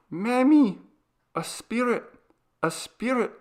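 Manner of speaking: high, loud terrified little voice; a scared small child crying out; frightened child. A narrator's reading. Maria calling to her new mother, high and frightened. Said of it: "Her eyes were wide and frightened".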